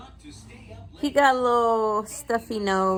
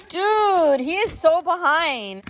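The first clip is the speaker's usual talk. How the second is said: higher, louder disbelief; exasperated exclaim; 7 semitones higher, 6 dB louder than usual. Real, not acted. Mom reacting to the late calendar, louder higher exasperation. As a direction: throw up your hands at it.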